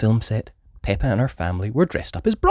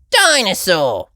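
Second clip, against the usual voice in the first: put-on higher, loud toddler shout; 8 semitones higher, 7 dB louder, slower than usual. A narrator's reading. Little George shouting at the ancient rocks, loud. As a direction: shout the word out. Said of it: "shouted George".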